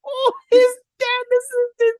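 high mock sob; imitating a crying viewer; mock sobbing kid. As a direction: squeak it out like a weepy child. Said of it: "I was like".

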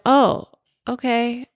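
retold meek reply to a scolding; drawn-out, sheepish okay; scolded child. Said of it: "I felt like a five-year-old".

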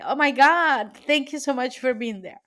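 getting emotional. Real, not acted. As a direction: voice lifting high as emotion breaks through. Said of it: "I'm getting emotional today".

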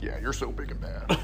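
soft, sarcastic put-down; quietly mock a foe who thinks he's tough; sly mockery. Jesus answering the demons, slyly mocking. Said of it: "He slyly smiles and says".

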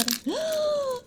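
sharp high-pitched gasp. Pretend. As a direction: gasp in mock alarm. Sudden alarm over fish.

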